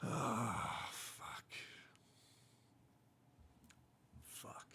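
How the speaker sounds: barely audible, defeated swearing; tiny hushed curse; whispered cursing. Real, not acted. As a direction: whisper it to yourself, spaced far apart. Defeated swearing after bald joke.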